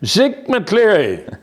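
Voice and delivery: drawn-out high call of a name, mimicking a voice behind him. A man calling out to the old teacher, slow and high. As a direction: call his name slowly across the shop.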